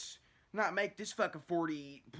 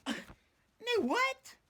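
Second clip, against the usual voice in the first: high squeaky disbelief at poison control; mock incredulous squeal; 11 semitones higher, 4 dB louder than usual. Retelling his reaction to poison control, squealing high in disbelief. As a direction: shriek it out, can't believe it. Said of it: "I'm like".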